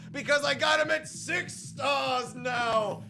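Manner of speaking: high, loud, slow gloating shout; real triumphant yelling. Real, not acted. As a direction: crow over your prize. Gloating over the six-star unit.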